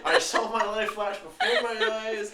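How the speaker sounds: laughing hard. Real, not acted. Nearly fell off chair, laughing.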